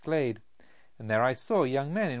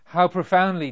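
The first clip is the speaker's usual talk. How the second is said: agitated; 5 semitones higher, 9 dB louder than usual. A narrator's reading. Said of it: "in an agitated voice".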